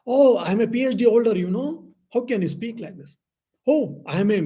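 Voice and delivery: playing a proud PhD holder who takes offence; proud boast. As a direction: puff up and lecture the listener. Voicing a proud degree holder.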